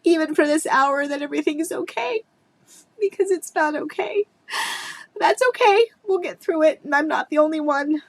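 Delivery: fighting through tears to keep talking; crying. Real, not acted.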